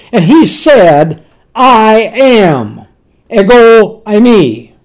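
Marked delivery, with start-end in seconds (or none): 1.5-4.7 long, weighty "I", then "Ego" hit hard; stress on I. Retold or imitated. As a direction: declare it with full weight on "I" and "Ego". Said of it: "The emphasis is on I".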